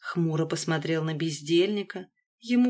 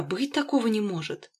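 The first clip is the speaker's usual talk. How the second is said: plain reading voice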